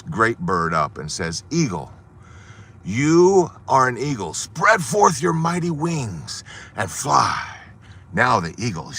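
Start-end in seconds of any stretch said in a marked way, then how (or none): 1.5-7.6 slow, grand proclamation; solemn command. Acted. Naturalist lifting the eagle on the ledge, slow solemn command. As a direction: draw out the command like a blessing.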